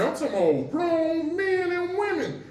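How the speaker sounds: high-pitched laugh breaking through; laughing despite himself at a grim memory. Real, not acted. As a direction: laugh bursting out mid-story. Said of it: "It ain't funny".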